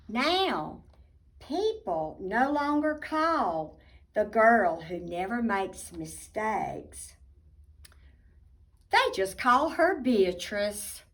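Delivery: put-on lively storybook narration for kids; reading to children. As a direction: tell it like a bedtime picture book. Reading picture book aloud.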